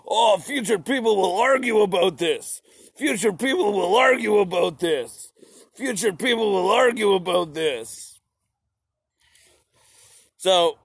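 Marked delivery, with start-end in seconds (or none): 0.1-8.2 higher, louder smug singsong; mock smug Marx. Narrator voicing Marx gloating while writing, higher and smug. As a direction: gloat over the future arguments. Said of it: "sitting there being like".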